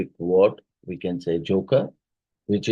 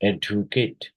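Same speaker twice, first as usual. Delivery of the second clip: "educate" with ED stressed, syllables set apart; stress on first syllable. Shown as a demonstration. Teacher answering a stress question, first-syllable stress on "educate". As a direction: lean on the ED of "educate". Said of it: "first syllable".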